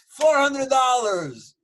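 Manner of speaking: high, loud, drawn-out price quote; Russian relative impression. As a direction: hawk it slowly as the émigré relative. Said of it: "And he goes".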